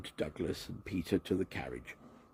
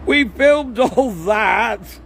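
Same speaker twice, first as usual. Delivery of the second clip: much higher, louder, slow call; shouted; 12 dB louder, much slower than usual. A narrator's reading. Cameraman shouting that they filmed it, high and slow. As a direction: call it out across the platform. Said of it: "shouted one of the cameramen".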